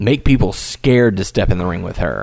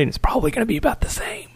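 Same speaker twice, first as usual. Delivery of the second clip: whisper; 8 semitones higher, 3 dB quieter, faster than usual. Real, not acted. Aside dropping to a whisper.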